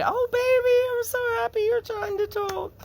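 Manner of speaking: put-on very high, bright sing-song to a baby; excited baby talk. Shown as a demonstration. Parent cooing to a baby trying to talk, high and excited. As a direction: coo it up high like praising a baby. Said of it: "You can just be excited".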